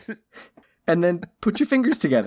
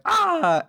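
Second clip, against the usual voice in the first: high mock yelp of pain; imitated cry of pain. Imitating the whipped actor playing Jesus, mock pained cry. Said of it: "actor playing Jesus is like".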